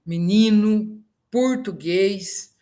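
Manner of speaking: "menino" como mininu, "português" como purtugueis; transformação das vogais. Shown as a demonstration. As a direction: troque e por i e o por u em "menino". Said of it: "é muito comum isso". ("português" devagar, com u na primeira sílaba)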